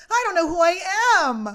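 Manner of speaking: loud, high, hammed-up wail; big overblown cry of confusion; Kyle. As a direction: belt it out as a mocking impression. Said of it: "my impression of Kyle".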